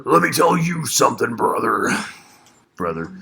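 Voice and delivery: wrestling promo. Pretend.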